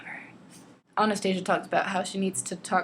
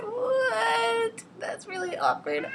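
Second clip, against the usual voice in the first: very high, drawn-out mock squeal; incredulous; much slower than usual. Reader reacting to meeting his mom mid-sex, incredulous. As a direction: play up the awkward disbelief, high-pitched.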